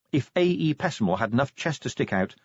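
plain reading voice

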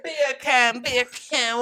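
light imitation Jamaican accent; copied Jamaican accent. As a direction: echo the joke in mock-Jamaican. Copies his Jamaican accent joke.

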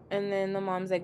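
ordinary delivery

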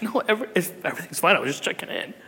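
a hint of the wife reassuring, fading away; husband quotes his wife's calm reply, softly. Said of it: "She's like".

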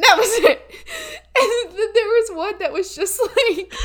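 real laughter breaking the words; laughing. Real, not acted. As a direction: laugh your way through it. Laughing at the mug photo.

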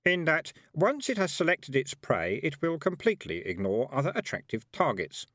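high, squeaky know-it-all; self-satisfied squeak. A narrator's reading. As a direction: lecture in a smug squeak. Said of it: "in its self-satisfied squeak".

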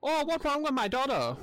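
loud, high mock Chinese accent, hammed up; a hammed-up caricature of a Chinese father. Friend mocking Jackie Chan in a loud, high put-on Chinese accent. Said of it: "Wrong Chinaman accent".

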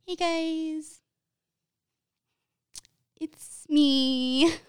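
high, drawn-out, sheepish hello; awkward greeting. Retold or imitated. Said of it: "I know y'all know I'm awkward".

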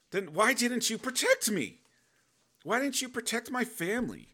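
high, loud anguished crying-out at God; upset pleading. Retold or imitated. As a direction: demand an answer from God, voice breaking upward. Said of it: "I start getting upset 'cause then I'm like".

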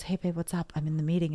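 imitating partner answering softly; soft, casual retold greeting; hushed phone answer. Voicing husband answering from a meeting.

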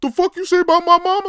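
fly off the handle at the insult; mock furious.

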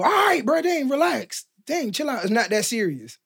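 high-pitched mock protest; exasperated brush-off. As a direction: act out telling an angry friend to ease up. Acted reply to someone mad.

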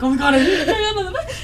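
high, loud, fast panicked laugh; mock terror, laughing through it; scared laughing panic. Roommate recalls her panic over the unchecked bed, laughing. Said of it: "Don't say that, 'cause I was like".

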